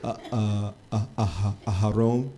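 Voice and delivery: stumbling, stammering Torah reading with long uhs; halting bad reader. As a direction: imitate a lost student reading badly. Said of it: "and I sounded like this".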